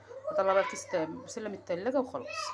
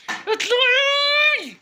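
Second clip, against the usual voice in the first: اطلعي stretched out very slow, much higher and louder; exasperated mum bellowing the single word اطلعي; long drawn-out yell; 12 dB louder, much slower than usual. Real, not acted. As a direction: holler اطلعي across the flat, dragging it out. Mother yells child out.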